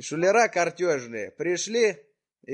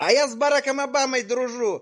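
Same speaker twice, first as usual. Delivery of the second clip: mocking boast; 8 semitones higher, 4 dB louder than usual. Prankster mocks her boast loudly.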